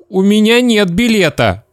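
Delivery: put-on high, timid child denial; scared boy. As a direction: answer a looming adult fearfully. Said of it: "сказал Сеня и задрожал".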